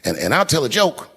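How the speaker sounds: usual speaking voice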